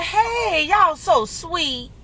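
high, sing-song voice imitating her mama; a sweet, delighted hello from mom; mom's sweet greeting. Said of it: "And she'd be like".

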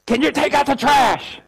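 high, loud mock scream at her husband; wife screaming. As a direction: shriek it like a fed-up wife. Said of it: "she screams at him".

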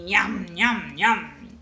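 playful chomping sounds; nom-nom eating imitation; munching noise. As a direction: mimic a wolf gobbling. Narrator imitates wolf eating.

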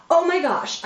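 stressed exclamation. Shown as a demonstration. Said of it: "response can look something like this".

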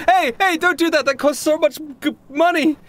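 very high-pitched, upset staffer voice; frantic protest. Acted. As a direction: squeal a protest at someone breaking your property.

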